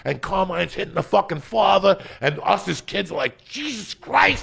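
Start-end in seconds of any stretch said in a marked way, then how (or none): 3.4-4.4 stunned. Retold or imitated. Kids stunned.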